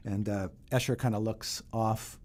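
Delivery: usual speaking voice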